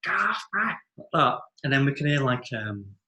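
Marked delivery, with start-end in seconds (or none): 0.0-0.6 high, loud mock cries of protest; high-pitched retold scream; imitated screaming. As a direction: imitate a woman screaming in a fight. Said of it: "like screaming".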